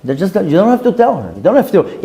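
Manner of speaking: ordinary talk, nothing marked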